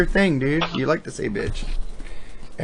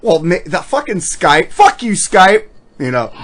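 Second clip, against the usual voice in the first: normal talking voice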